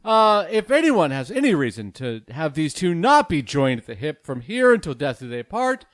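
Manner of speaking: loud, high, ceremonious officiant voice; wedding officiant. Acted. Officiant addressing the wedding guests, high and ceremonious. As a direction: preside over the vows with pomp.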